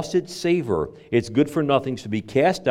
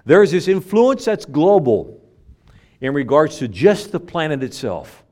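usual speaking voice

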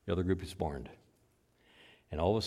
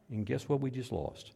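ordinary delivery

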